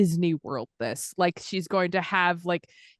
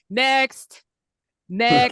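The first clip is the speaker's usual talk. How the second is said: loud, raised call down the line; brisk queue call; 4 semitones higher, 6 dB louder than usual. Acted. Quenhild hurrying people past the stone, loud officious call. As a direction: summon the next hopeful, louder and higher. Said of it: "Quenhild's just going".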